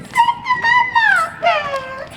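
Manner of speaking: tiny squeaky voice up high; squeaky high echo. Acted. Squeaky high echo for the kids. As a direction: squeak it like a toy.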